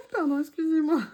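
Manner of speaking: excuses en riant, aigu; rire gêné. Real, not acted. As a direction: petit rire confus en s'excusant. Rire après avoir éternué. (rire gêné et aigu)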